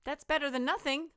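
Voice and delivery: put-on a sly kid with a plan; little skeleton. Little skeleton suggests frightening each other, high and sly.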